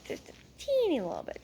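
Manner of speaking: high, small squeaky voice. Real, not acted. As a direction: shrink it to a tiny high squeak. Playfully minimising the joke.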